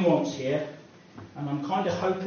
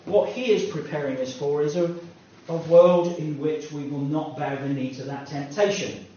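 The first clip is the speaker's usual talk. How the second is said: usual speaking voice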